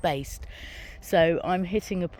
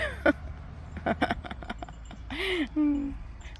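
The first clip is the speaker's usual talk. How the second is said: a high giggling laugh at her own joke; breaking into a high-pitched laugh; 11 semitones higher, 5 dB quieter than usual. Real, not acted.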